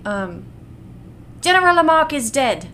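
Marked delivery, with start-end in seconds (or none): 1.4-2.7 lightly voiced little boy; a hint of a street kid; Gavroche voice. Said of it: "he sounds like a little boy, not like me".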